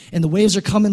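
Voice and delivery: ordinary delivery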